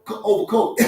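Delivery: chatty lion. Pretend. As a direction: greet your prey like an old pal. Lion chatting to zebra Frank.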